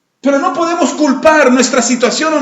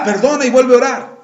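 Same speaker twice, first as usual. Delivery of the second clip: ordinary delivery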